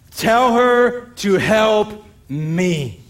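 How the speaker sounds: very slow, resentful demand. Retold or imitated. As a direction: drag it out with bitter resentment. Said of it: "there's this resentment just stuck in her words".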